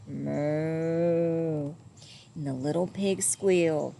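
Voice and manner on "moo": low gently like a tired cow. A narrator's reading. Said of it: "the big cow lowed".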